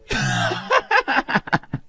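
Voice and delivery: derisive cackle; mocking laugh. Husband laughing at the apology he dictated, mocking laugh. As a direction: crack up at the mock apology.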